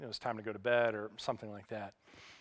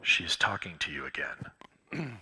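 slow, low stage whisper; drawn-out whispered inner voice; little voice in head; 8 semitones lower, slower than usual. Retold or imitated. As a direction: whisper it slowly, like a nudging conscience. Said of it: "I hear the little voice in my head again".

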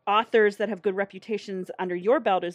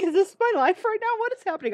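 high, incredulous inner voice; amazed disbelief; 4 dB louder than usual. Retold or imitated. To the listener, recalling hearing Mary narrate at her table, much higher in stunned disbelief. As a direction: replay your own stunned wonder. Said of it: "I was just like".

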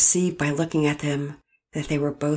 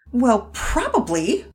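slow, higher, grudging; spit out; 8 semitones higher, 4 dB louder, slower than usual. Acted. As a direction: spit the word out. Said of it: "spit out".

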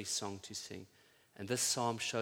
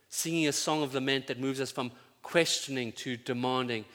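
normal talking voice

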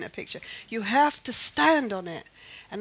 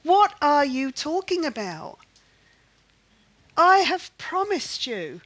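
God's raised, emphatic rebuke, pitched up; mock scream; 6 semitones higher, 5 dB louder than usual. God scolding a doubting grandmother, mock scream. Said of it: "God had to scream at me".

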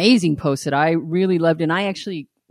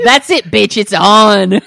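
loud, pumped battle cry; mock fired-up lion; 3 semitones higher, 11 dB louder, slower than usual. Lion answering lioness's challenge with a loud fired-up shout. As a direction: shout it like you're ready to brawl. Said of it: "he's like".

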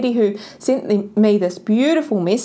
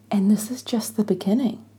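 "this is just the beginning" whispered, softer; 4 dB quieter than usual. Real, not acted. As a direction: breathe it like a secret. Repeats the line as a whisper.

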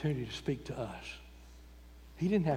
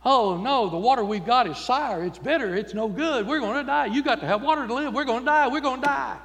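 high, loud, frantic wailing; hammed-up terror over bad water; mock panic; 8 semitones higher, 8 dB louder than usual. Israelites panicking over bitter water.

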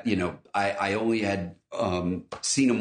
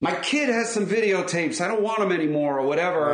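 seller voice; 7 semitones higher than usual. Retold or imitated. Imitating a seller with old tapes.

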